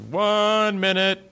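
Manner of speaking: high, slow "One minute" callout; stage manager call. Retold or imitated. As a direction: call the time slowly to the whole studio. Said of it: "they go".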